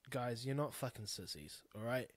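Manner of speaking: usual speaking voice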